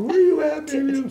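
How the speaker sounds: high, loud, impatient squeal; imitated excited mother. Mother asks son where he is, imitated excitement. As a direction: cry it out high and eagerly. Mother eager for his location.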